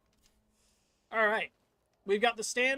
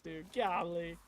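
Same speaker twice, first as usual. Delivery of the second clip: ordinary talk, nothing marked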